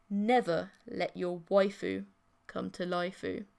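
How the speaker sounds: ordinary delivery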